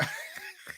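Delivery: high, soft giggle. Real, not acted. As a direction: giggle under your breath. Laughs mid sentence.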